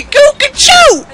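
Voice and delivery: very high falsetto hoot; silly bird call. Pretend. Player getting friend's attention, silly high falsetto call. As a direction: squeak it out like a cuckoo.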